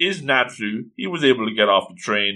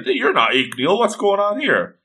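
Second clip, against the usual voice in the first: confused Natsu; 7 semitones higher than usual. Acted. Natsu confronting Salamander, confused. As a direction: pitch climbing in puzzled question. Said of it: "Natsu's very confused".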